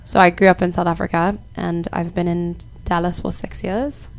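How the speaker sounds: everyday conversational South African accent; relaxed South African accent, demonstrating it on request. Shown as a demonstration. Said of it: "now talking in my South African accent".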